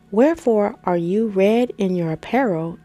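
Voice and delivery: normal talking voice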